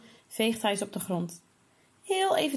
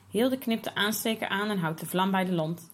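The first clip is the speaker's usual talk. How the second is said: usual speaking voice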